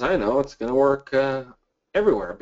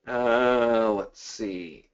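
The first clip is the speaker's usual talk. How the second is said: ordinary talk, nothing marked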